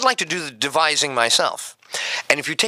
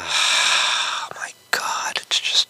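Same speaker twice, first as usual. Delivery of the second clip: whisper; 8 semitones lower, much slower than usual. Real, not acted. Sighing over life.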